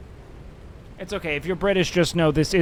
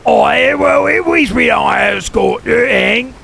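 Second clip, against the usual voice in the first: loud, high hammed-up London shout; mock British accent; 5 semitones higher, 9 dB louder than usual. Mocking a British viewer's comeback, loud mock London accent. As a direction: yell it like a cartoon Brit.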